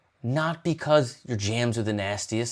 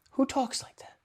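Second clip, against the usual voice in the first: whispered, much higher, in awe; 14 semitones higher, 7 dB quieter than usual. Real, not acted. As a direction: squeak it out in a breathless whisper. Amazed at Mark's words.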